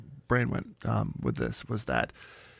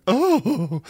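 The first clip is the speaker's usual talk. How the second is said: swooning oh; 7 semitones higher than usual. Retold or imitated. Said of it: "suddenly they're like".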